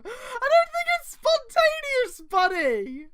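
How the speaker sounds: slow, high and loud mock outrage; mock indignant. Real, not acted. Reading group mocks the fanfic, slow and high. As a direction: scoff at the story's wording.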